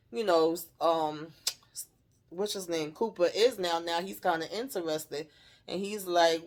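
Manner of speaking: normal talking voice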